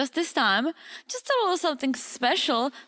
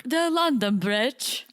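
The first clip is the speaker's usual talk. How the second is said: slow mock British accent; slower than usual. Shown as a demonstration. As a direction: announce it in put-on British. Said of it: "I'll give you some British accent".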